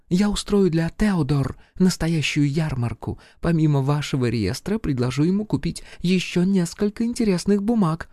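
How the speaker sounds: high-pitched French lady voice; femme fatale. Acted. Charlotte's voice again.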